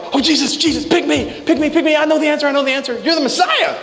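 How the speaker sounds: very high, bursting with the answer; overeager Peter. Retold or imitated. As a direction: squeal it like a know-it-all kid. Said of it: "Peter's like".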